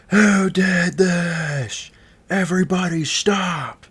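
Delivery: high, loud, drawn-out mock scream; screaming teacher. Teacher finds the smashed muffin, screaming in outrage. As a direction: imitate a teacher shrieking across the gym. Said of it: "she was just screaming".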